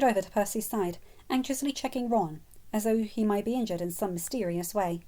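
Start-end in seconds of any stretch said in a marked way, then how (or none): none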